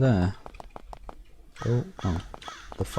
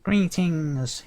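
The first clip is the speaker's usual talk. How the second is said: high, formal little sprite voice; tiny sprite chief; 8 semitones higher than usual. Acted. Sprite chief greeting the visitor, high and formal. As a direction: greet like a small proud fairy chief. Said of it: "he says".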